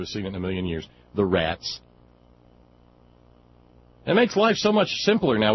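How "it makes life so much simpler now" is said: loud, much higher, gleeful delight. Real, not acted. Host gloating to listeners about the rats nickname, gleeful. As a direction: crow with delight over the new nickname.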